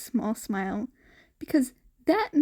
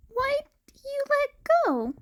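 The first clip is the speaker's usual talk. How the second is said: innocent question; faster than usual. Acted. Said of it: "Deku asked innocently".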